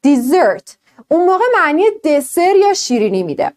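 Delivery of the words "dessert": dessert with stress on the second syllable, falling. Shown as a demonstration. Teacher models dessert for the class, stress last. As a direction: push the stress onto the end of dessert. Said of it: "استرس میره روش".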